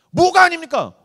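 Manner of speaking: high, loud indignant protest; acting out an outraged soccer fan; indignant young churchgoer. Indignant youth arguing with pastor.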